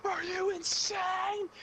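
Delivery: high, slow, stretched-out scream; mom screaming. Retold or imitated. Mom screaming at son sneaking back in, high drawn-out shriek. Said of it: "She screamed so loud".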